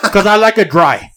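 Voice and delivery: slow it down and laugh through the punchline; laughing joke. Retold or imitated. Joking answers at bag check.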